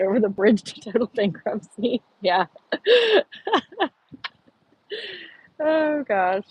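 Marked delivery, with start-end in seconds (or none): 2.7-3.9 laugh. Real, not acted. Laughing at bankruptcy joke.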